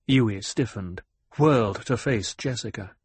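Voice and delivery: ordinary delivery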